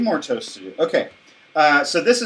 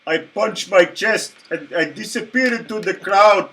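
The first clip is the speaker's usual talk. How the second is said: put-on Middle Eastern accent; 4 semitones higher than usual. Joining the magician bit.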